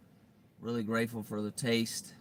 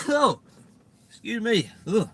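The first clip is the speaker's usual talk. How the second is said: high loud recovery from a sneeze; startled excuse me after sneezing; 13 semitones higher, 14 dB louder, slower than usual. Real, not acted.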